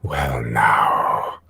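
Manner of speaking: slow, menacing dragon voice, lightly voiced; a dragon toying with the thieves; deep threatening dragon. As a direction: loom over them and savour it. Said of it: "in a very deep, very familiar, threatening voice".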